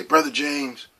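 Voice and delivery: pastor's voice. Retold or imitated. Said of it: "He would say".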